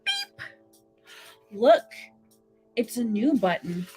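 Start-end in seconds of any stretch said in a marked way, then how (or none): none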